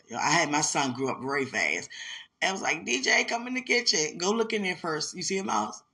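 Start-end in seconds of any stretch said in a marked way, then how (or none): 2.8-5.8 loud, high call across the house; calling out to son. Retold or imitated. Mother calling her son to check for a mouse, loud and high. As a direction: shout to the kid in the next room.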